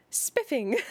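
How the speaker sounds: put-on a touch of a plummy, delighted girl; delighted exclamation.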